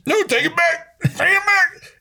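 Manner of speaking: high-pitched strained plea; hammed-up fighting back tears; mock tearful protest. Man acting a viewer upset at the war movie, mock choked-up plea. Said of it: "don't at least get one single tear or".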